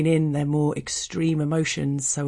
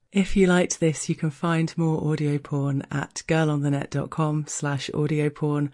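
ordinary delivery, nothing marked

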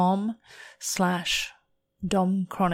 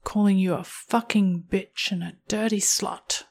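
sultry story narration; 4 dB louder than usual. Acted. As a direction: read it close-mic and commanding, savouring each line.

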